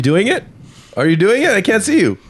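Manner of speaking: playful excited. Acted. Playing along with bear joke.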